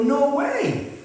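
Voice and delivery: high-pitched, disbelieving retold reaction; acted amazement. Pastor recognising a woman from his old group, amazed. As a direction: let the pitch leap in delighted disbelief.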